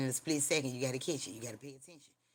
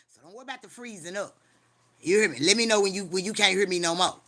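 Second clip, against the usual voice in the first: louder and higher, driving each line home; fired-up street preaching; 3 semitones higher, 8 dB louder than usual. Real, not acted. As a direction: preach it hard to the congregation. Preaching at full force.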